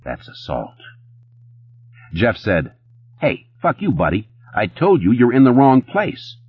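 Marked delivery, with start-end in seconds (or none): 0.0-0.8 low, very soft statement; understated quiet warning. A narrator's reading. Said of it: "Connor said quietly". 3.2-6.4 higher-pitched, angry challenge; heated tough-guy aggression; angry confrontation. A narrator's reading. Bouncer turns on Connor before fight.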